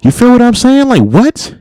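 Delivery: high, loud disbelieving outburst; incredulous shout. Real, not acted. Indignant outburst.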